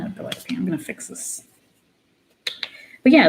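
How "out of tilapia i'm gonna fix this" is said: unvoiced under-the-breath remark; whispered aside. Real, not acted. Vlogger fixing her camera, whispering to herself. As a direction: say it to yourself in a whisper.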